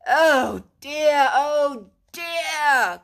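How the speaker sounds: loud, anguished groan; despairing. A narrator's reading. Rat despairs at Mole's obtuseness. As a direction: despair at his friend's slowness. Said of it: "cried the rat in despair".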